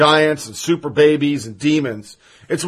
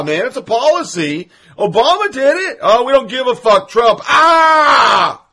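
rising, louder mocking rant; 5 semitones higher, 4 dB louder than usual. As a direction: yell it out, getting shriller. Ranting impression of outraged critics.

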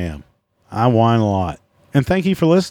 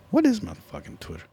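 much higher, softer, incredulous; can't believe he forgot; baffled outburst; 11 semitones higher, 8 dB quieter than usual. Real, not acted. Searching for his own Twitter handle, baffled.

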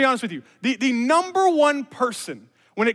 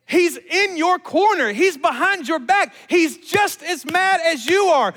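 loud, high, emphatic sermon delivery; impassioned preaching; 9 semitones higher, 5 dB louder, slower than usual. Real, not acted. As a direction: preach it slowly and forcefully, punching key words. Preaches at full force.

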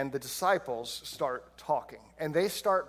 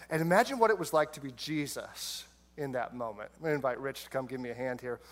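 usual speaking voice

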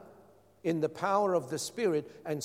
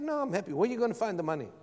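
higher-pitched mimicry of a wife, easing down; imitated doubtful wife; 5 semitones higher, much faster than usual. Preacher retelling his wife's answer in the car, mock protest. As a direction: lightly mimic her protest.

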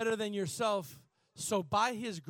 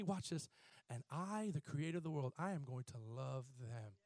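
much softer and lower, intimate; hushed; 7 semitones lower, 12 dB quieter than usual. Real, not acted. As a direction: bring it down to a near hush.

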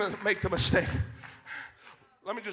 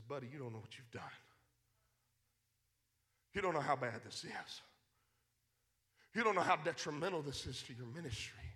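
low, quiet, grave warning from the prophet; a hint of a stern prophet, hushed; hushed prophet rebuke; 5 semitones lower, 14 dB quieter than usual. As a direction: lean in and warn him softly, like a prophet.

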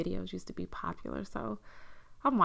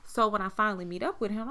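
normal talking voice

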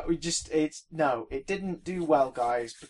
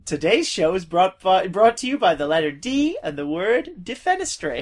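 louder, bright presenter sign-off; children's TV announcer; 3 semitones higher, 8 dB louder than usual. Pretend. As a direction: announce it like kids' TV. Mock kids' TV sponsor line.

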